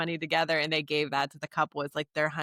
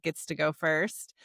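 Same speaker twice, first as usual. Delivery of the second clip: ordinary talk, nothing marked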